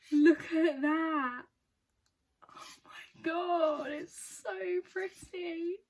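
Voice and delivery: slow, high, drawn-out squeals of awe; overexcited. Real, not acted. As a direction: stretch each word in overwhelmed delight. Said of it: "getting this excited over a book".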